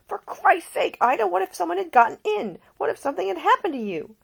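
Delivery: fast panicked climb in pitch; voice risen high. Acted. Nick fears for Ida, high frantic voice. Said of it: "His normally low voice had risen nearly a full octave".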